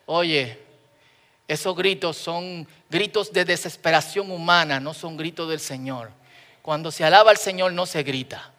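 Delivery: low, hushed pastoral reproof; imitating a pastor's soft admonishment; lightly voiced stern pastor. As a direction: quietly lecture the youth group, a touch grave. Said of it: "el pastor hizo una reunión con nosotros y nos dijo".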